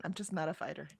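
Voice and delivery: softly conceding it again; quiet repeat. Real, not acted. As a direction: let it drop away quietly. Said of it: "I'm really not".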